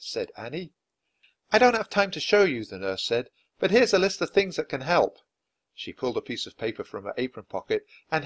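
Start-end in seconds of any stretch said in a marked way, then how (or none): 1.5-2.6 put-on lightly raised voice for a brisk young nurse; a hint of a busy nurse giving orders; slightly higher, brisk nurse. A narrator's reading. 3.6-5.2 put-on lightly raised voice for a brisk young nurse; a hint of a busy nurse giving orders; slightly higher, brisk nurse. A narrator's reading.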